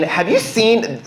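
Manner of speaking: normal talking voice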